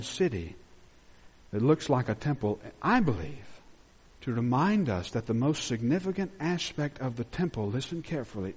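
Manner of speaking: intimate, reverent sermon voice; hushed preaching. Real, not acted. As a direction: draw them in with a hush.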